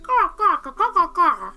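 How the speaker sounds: high cawing like a crow. Acted. Character's greeting comes out as crow caws, high bird calls. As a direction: caw it out like a bird. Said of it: "it comes out like".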